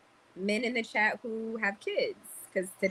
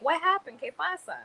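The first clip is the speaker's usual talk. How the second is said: higher-pitched imitation of concerned strangers; nosy onlookers; 9 semitones higher than usual. People in the store asking about her bruises, nosy onlookers. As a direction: mimic curious people asking, pitched well up.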